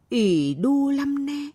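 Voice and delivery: high, long-held acted wail; tearful lament. A narrator's reading. Relatives weep on seeing the girl, tearful lament. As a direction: draw it out as a keening pity cry. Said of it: "hễ nhìn thấy Pai là khóc".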